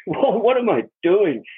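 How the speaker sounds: amused disbelief, drawn out; laughing at himself. Retold or imitated. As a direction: laugh at your own foolishness.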